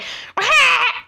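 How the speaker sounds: short high-pitched monkey-like screech; dying monkey noise. Retold or imitated. Classmate's awful noise at a joke, like a dying monkey. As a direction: imitate an awful weird dying-monkey laugh. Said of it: "like a dying monkey or something".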